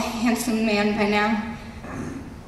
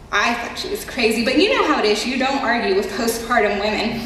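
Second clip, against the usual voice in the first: usual speaking voice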